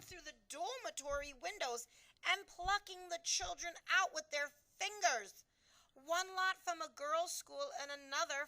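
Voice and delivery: a queen reliving a horrid dream; distressed Queen. A narrator's reading. Queen telling her maid the nightmare, distressed.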